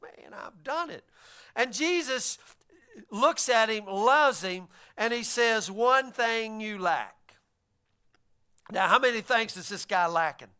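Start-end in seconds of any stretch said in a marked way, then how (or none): 0.0-1.0 arrogant conceit. Acted. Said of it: "you can hear the arrogance and conceit". 5.7-7.2 very slow, each word spaced; slow, weighty. Acted. Preacher voicing Jesus, slow and solemn.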